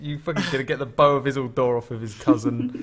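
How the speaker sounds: loud laughing teasing. Real, not acted. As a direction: laugh through the teasing words. Teasing a friend about Aragorn.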